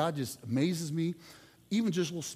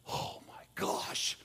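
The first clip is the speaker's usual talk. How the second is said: slow, breathless whisper; awed whisper; 8 dB quieter, much slower than usual. Retold or imitated. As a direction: whisper it like a stunned rookie. Scared rookie watching Jordan.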